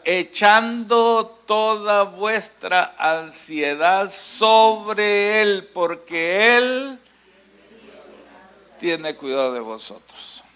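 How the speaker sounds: slow, sung-out verse with long held vowels; drawn-out scripture reading. Acted. Preacher reading the verse to the congregation, slow and sung out. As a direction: linger on every word of the verse.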